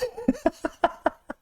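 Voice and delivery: high squeaky laugh. Real, not acted. Giggling at how rude she was. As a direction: let a high giggle escape. Laughing while telling the story.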